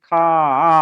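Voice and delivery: খা with the long aa held on; open syllable. Shown as a demonstration. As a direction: stretch খা into a sustained, open-mouthed আ. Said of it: "মুখটা খোলা আছে".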